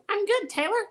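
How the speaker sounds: high ventriloquist dummy voice; squeaky puppet talking back. Acted. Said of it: "doing my act".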